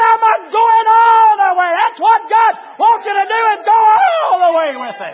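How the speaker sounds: high, loud shouted preaching; full-force preaching. Acted. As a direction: preach it hard, raising the roof.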